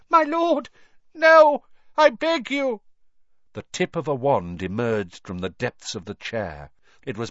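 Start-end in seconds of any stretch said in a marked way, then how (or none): 0.1-2.8 begging in terror. A narrator's reading. Servant begs for mercy.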